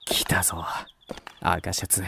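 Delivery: hushed whisper. Acted. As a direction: whisper so no one hears. Hiding and watching in the dark.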